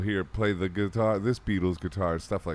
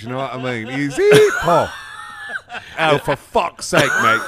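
louder, higher cockney producer bit with laughs; English accent; 5 semitones higher, 8 dB louder than usual. Pretend. Producer losing patience with Paul, in mock English accent. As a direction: play an exasperated London engineer. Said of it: "Did you slip into an English accent?".

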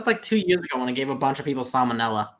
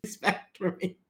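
mock heartbreak; 7 semitones higher, much slower than usual. Mock hurt friend.

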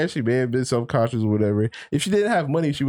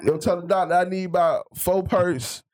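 mock dying gangster; 8 semitones higher, slower than usual. Mocking a shot guy dying.

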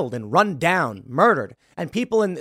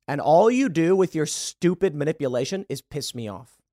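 usual speaking voice

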